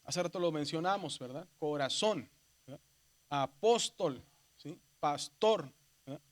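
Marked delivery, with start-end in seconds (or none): 1.6-2.3 "Corazón" broken up, weight on the last syllable, zón; stressed final syllable. Shown as a demonstration. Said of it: "la sílaba que se escucha más fuerte". 3.3-4.2 "Apóstol" with the pós punched out; stressed middle syllable. Shown as a demonstration. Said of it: "la sílaba que se escucha más fuerte". 5.0-5.7 "Pastor" split, with the tor punched; stressed final syllable. Shown as a demonstration. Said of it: "la sílaba que se escucha más fuerte".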